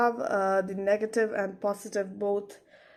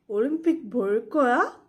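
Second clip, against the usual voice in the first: 올림픽 볼 거야 louder, swooping up at the end; question intonation; 5 dB louder, much faster than usual. Shown as a demonstration. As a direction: ask 올림픽 볼 거야 with a rising finish. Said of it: "read as questions".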